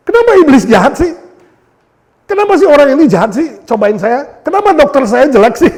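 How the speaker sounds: high, loud exasperated rant; mock complaint. Believer complaining at the devil instead of God, loud mock rant. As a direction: rail at the devil in a hammed-up huff.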